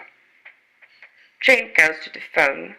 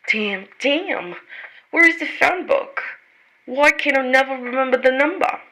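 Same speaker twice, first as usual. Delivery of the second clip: flustered, cursing under pressure; frantic cursing; 6 semitones higher than usual. Acted. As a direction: mutter curses at yourself in a panic. Jane frantic searching phone book.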